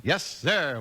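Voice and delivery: usual speaking voice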